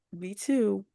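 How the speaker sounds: normal talking voice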